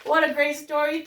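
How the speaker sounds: ordinary delivery, nothing marked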